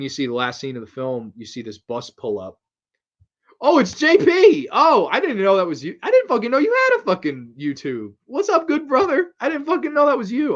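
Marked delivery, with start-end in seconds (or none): 3.6-10.6 much higher and louder, bursting with delight; excited shout. Real, not acted. Greeting best friend J P's comment, excited shouting. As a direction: yell it like you just spotted your best friend.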